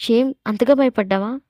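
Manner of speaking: ordinary delivery, nothing marked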